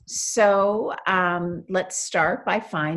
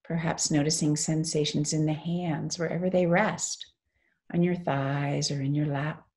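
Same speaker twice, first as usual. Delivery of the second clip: guided meditation. Real, not acted. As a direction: lead them gently into stillness, phrase by phrase.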